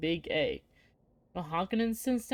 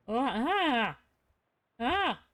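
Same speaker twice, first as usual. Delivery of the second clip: sudden gasping "oh, ah!" higher up; startled cry; 5 semitones higher, much slower than usual. Pretend. Player sees the room burst into flames, startled yelp. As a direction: jolt back as if flames just appeared. Dream room catches fire.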